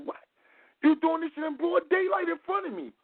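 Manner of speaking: pitched far up, imitating his own indignant shout; a retold, mock-scandalized squawk at the girl; mock outrage. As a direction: act out the disbelief, high and hammed-up. Retold confrontation in the park.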